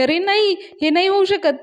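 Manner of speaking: imitating a group's stunned denial; shocked disbelief. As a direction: recoil at the news as if it can't be true. Everyone reacting in disbelief.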